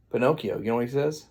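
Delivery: ordinary delivery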